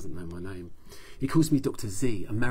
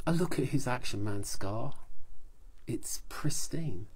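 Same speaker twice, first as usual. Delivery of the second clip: usual speaking voice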